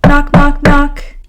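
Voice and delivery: knock, knock, knock, rapped out loud and high. Acted. Narrator sounding out Mouse knocking at Hedgehog's door, loud.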